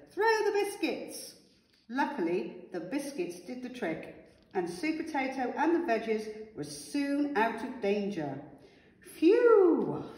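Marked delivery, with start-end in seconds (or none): 0.1-1.3 much higher, urgent command; urgent shout. A narrator's reading. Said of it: "shouted Sweet Potato". 9.0-10.1 loud, high, drawn-out phew; relieved phew. A narrator's reading. Relief once out of danger.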